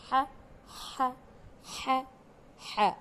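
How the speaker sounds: ح pushed out as a strong breath from the throat; throaty ح. Shown as a demonstration. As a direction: repeat ح slowly and clearly. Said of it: "ح کی بھی ... sound ایک ہی ہے". (حلق سے ح کی زوردار آواز)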